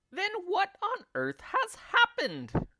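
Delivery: put-on shrill old woman crying out. A narrator's reading. Wife crying out to her husband, high and loud. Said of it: "cried Missus Twit".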